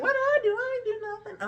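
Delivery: exaggerated viewer imitation, falling off the chair; mock shocked viewer. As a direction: squeal like an offended viewer. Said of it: "You guys probably like".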